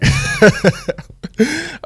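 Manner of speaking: loud, high mock laugh; imitated bitter cackle of disbelief; sarcastic laugh. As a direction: laugh sarcastically at bad news. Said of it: "I'm being sarcastic and whatnot".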